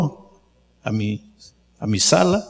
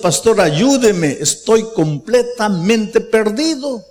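raised, slowed pleading cry for help; pleading through tears; 6 semitones higher, slower than usual. Retold or imitated. Young man crying to his pastor for help, slow pleading. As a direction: stretch ayúdeme out like a tearful plea. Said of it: "estaba delante de mí llorando y diciendo".

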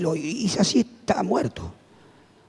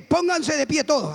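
slow, higher call to the congregation; authoritative command; 4 semitones higher, slower than usual. Retold or imitated. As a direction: order the whole room up with authority. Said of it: "ya tenía la autoridad".

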